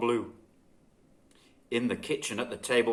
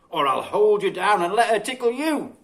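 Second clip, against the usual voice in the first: loud, teasing mock warning; a mum joking with her kids; playful threat; 6 semitones higher, 7 dB louder than usual. A narrator's reading.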